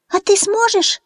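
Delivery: very high squeaky chick peep; peeping baby chick. A narrator's reading. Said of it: "пискнул цыплёнок Фью".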